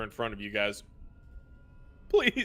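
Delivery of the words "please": high-pitched, pleading whimper; whimpering plea. Acted. Captives whimpering in front of the party, tearful high plea. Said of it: "begin to cry and kinda whimper".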